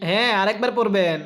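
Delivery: usual speaking voice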